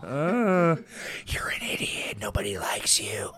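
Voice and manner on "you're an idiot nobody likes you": whispered goblin voice; whispering goblin taunting a YouTuber; goblin in your ear. Pretend. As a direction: hiss it like a goblin on your shoulder. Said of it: "some weird goblin just in your ear".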